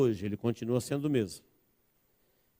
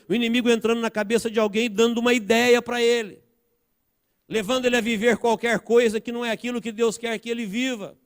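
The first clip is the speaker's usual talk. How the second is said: high, relentless sermon build; urgent pulpit crescendo without letup; impassioned preaching; 10 semitones higher, 4 dB louder than usual. Real, not acted. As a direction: drive each point home to the congregation. Preaches at full force.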